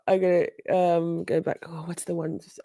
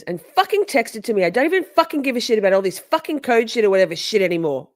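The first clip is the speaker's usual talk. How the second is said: higher, louder, fast mock rant; mocking Katie; 5 semitones higher, 5 dB louder, faster than usual. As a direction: rattle it off like fed-up Katie. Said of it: "Katie goes".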